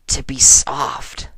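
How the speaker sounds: slow breathy whisper. Acted. Rainbow confessing to AJ, slow whisper.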